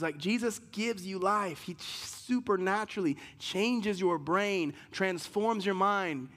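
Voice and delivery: full-voiced sermon preaching; preaching at full force. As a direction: drive the scripture home to the church.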